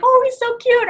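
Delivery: put-on high cooing at a cute kid; mock cooing. Coworkers cooing over her son on a video call. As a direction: coo like charmed coworkers.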